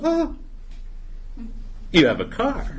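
high "oh", then a sly pause; feigned surprise. Acted. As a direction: act as if just discovering something to bargain for. God feigns surprise, haggling.

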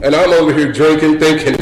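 ordinary talk, nothing marked

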